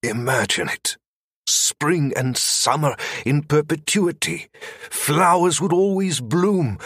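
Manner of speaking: softened, pleading tone; soft pleading. A narrator's reading. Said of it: "softened his tone and pleaded".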